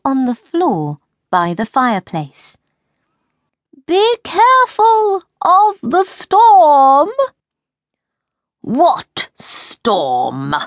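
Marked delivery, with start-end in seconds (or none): 3.8-7.1 high, slow and loud warning call; high queen voice calling a warning. Acted. 8.6-10.6 a lower, puzzled king's voice; the king, lower, worried question. Acted. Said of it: "said the king".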